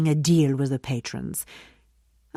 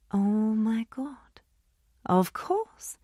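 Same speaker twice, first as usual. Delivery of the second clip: softer, slow dawning realization; quiet realization; 4 semitones higher, 8 dB quieter, slower than usual. Acted. Oma realizing her leverage, hushed and slow. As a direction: hush as the idea lands.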